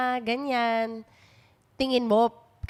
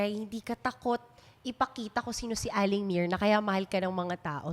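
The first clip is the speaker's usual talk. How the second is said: usual speaking voice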